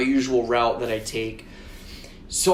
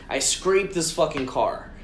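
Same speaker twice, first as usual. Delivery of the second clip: normal talking voice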